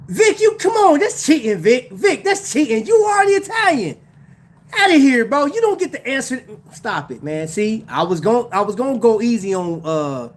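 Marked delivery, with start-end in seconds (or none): 0.1-6.4 loud, high-pitched mock outrage; playful protest. Real, not acted. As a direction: call out a friend for cheating. Playfully scolds chatter for cheating.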